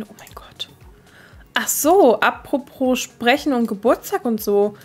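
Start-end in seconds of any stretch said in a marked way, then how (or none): 0.0-0.7 whisper. Real, not acted. Surprised at Ruby talking.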